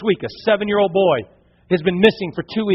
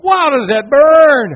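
mock pained yelp; 7 semitones higher, 7 dB louder, much slower than usual. Retold jellyfish sting burning.

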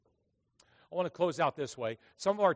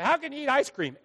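mock questioner; 11 semitones higher, 8 dB louder than usual. Mocking people's question.

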